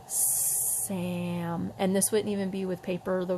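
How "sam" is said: "Sam" stretched out slowly and soft; "Sam" sounded out slowly. Shown as a demonstration. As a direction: draw "Sam" out for the child. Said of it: "Say Sam slow".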